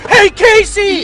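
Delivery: big high heroic shout. Pretend. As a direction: bellow it as you burst in. Shouting as they kick in.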